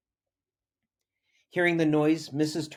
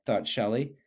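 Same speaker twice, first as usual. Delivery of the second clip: ordinary delivery, nothing marked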